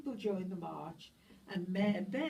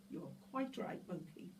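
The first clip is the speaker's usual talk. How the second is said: hushed agreement; 9 dB quieter than usual. A narrator's reading. Agreeing softly.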